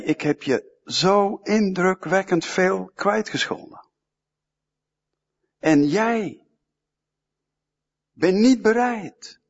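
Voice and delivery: a hint of an angry king scolding his servant. Angry king rebukes the unforgiving slave, indignant. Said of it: "die koning werd zo boos".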